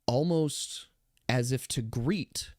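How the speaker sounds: eerie, quiet game master narration; hushed suspense narration. A narrator's reading. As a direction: draw them in with a hushed voice. GM narrates eerie silent moment.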